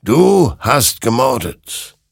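slow, loud, high condemnation; accusing. A narrator's reading. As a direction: pronounce the guilt heavily, word by word. Creature accuses Hector.

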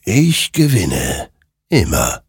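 slow, drawn-out creature voice; murmuring creature. A narrator's reading. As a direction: answer slowly, each phrase set apart. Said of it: "murmelte dieser".

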